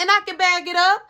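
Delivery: high, loud comeback; imitated fighting challenge. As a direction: act out a girlfriend ready to fight. Girlfriend challenges rival to fight.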